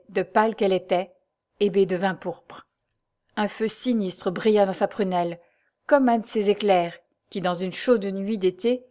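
ordinary delivery, nothing marked

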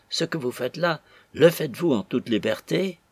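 ordinary delivery, nothing marked

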